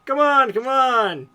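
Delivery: high, loud, drawn-out beckoning; hammed-up eager calling; frantic urging. A narrator's reading. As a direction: urge someone along in mock excitement. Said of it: "he motioned frantically".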